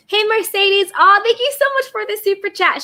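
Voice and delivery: high, bright thank-you; excited thanks. Real, not acted. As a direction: gush over the super chat.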